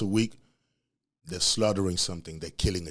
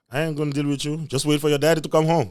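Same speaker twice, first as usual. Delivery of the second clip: mom's warning; 5 semitones higher, 4 dB louder, faster than usual. Retold or imitated. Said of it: "She was like".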